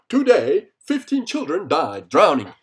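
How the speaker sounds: loud, hammed-up headline read; mock news announcer. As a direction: announce the bad news like a TV anchor.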